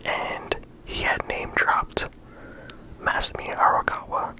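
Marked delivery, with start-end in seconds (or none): none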